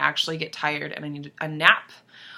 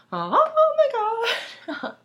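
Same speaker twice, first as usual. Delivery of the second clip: very high, drawn-out fan squeal; getting emotional; much slower than usual. Real, not acted. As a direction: squeal it slowly, overcome by the singer. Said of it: "I'm getting emotional".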